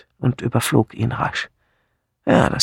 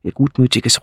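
ordinary delivery, nothing marked

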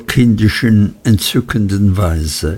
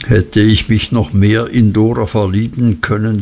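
ordinary delivery

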